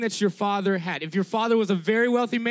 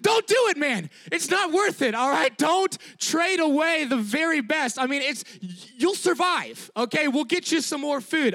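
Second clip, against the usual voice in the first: pleading shout; 4 semitones higher than usual. Pretend. Preacher shouting to Esau not to trade the birthright. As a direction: yell at him to stop, much higher. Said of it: "I'd be like".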